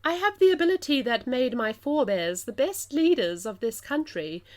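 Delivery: a lighter plummy English queen warning; plummy English accent, warning the girl. Acted. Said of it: "my plummiest English accent".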